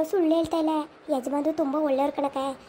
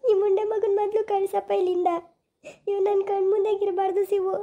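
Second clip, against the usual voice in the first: tearful, choked plea, pitched up; weeping mother begging her son, higher; crying; 4 semitones higher than usual. Acted. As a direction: sob the words out to your son. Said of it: "ಮೊದ್ಲು ಅಳೋದ್ ನಿಲ್ಸು ನೀನು".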